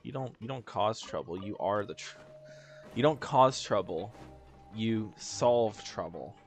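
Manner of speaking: ordinary delivery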